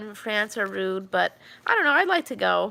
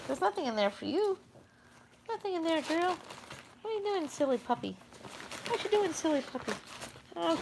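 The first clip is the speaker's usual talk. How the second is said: higher, much softer, quick puppy coo; 7 semitones higher, 8 dB quieter than usual. Real, not acted. Owner coos at her snooping dog, high and soft. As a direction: tease the puppy gently in a tiny voice. Cooing at dog sniffing something.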